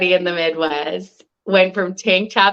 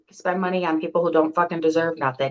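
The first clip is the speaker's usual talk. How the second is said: ordinary talk, nothing marked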